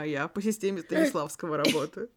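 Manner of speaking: ordinary talk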